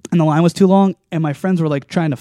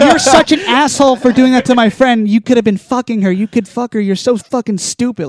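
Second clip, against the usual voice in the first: high, louder mock scolding; retold angry girl; 7 semitones higher, 5 dB louder than usual. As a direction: imitate a girl telling you off, pitched up. Retold girl berating him to his face.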